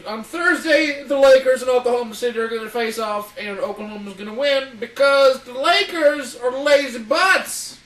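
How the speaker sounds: sports announcer. Acted. Mock sports announcer bit.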